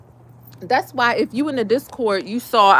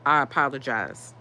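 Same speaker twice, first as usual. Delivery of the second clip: meek apology; 10 semitones lower, 8 dB quieter than usual. Retold or imitated. Mimicking the podiatrist's reply.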